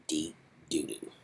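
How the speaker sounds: usual speaking voice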